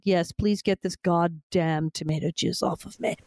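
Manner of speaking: slow, emphatic diva demand; a small child talking like a grand film star, each word weighed; imperious, drawn-out delivery; Bette Davis at six. Retold or imitated. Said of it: "It was like Betty Davis at six".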